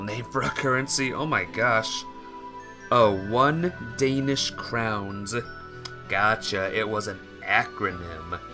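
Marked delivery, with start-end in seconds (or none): none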